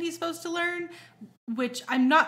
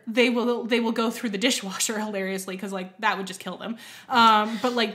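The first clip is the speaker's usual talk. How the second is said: ordinary talk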